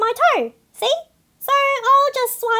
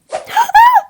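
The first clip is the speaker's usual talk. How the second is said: sharp, high gasp, much louder; startled gasp; 13 semitones higher, 9 dB louder than usual. Acted. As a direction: gasp in sudden shock. Seagull startled mid-speech.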